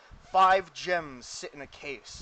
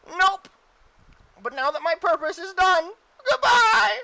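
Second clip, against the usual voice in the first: very high, very loud squeal; Mister Meeseeks; 12 dB louder than usual. Acted. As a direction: belt out a squeaky, final farewell. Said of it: "Ask Mister Meeseeks if he knows anything".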